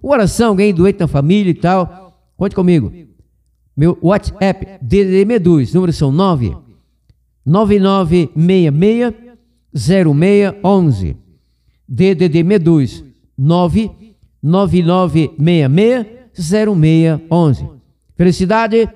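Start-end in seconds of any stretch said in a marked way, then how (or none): 3.7-11.2 digits read out very slowly, one by one; slow dictation. Real, not acted. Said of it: "Direi mais duas vezes". 11.9-17.7 digits read out very slowly, one by one; slow dictation. Real, not acted. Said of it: "Direi mais duas vezes".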